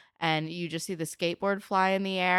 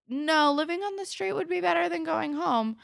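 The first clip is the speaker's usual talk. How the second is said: higher, louder desperate plea; desperate Craig; 12 semitones higher, 4 dB louder than usual. Retold or imitated. Craig refusing to go home, pleading. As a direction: voice a boy desperate to run away. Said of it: "And he's like".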